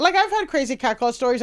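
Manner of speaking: ordinary talk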